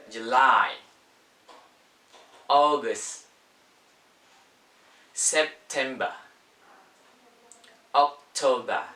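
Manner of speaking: British English, "-ber" endings softened to "-ba"; British pronunciation. Shown as a demonstration. Teacher showing how to say month in British, British accent. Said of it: "Oke, in British".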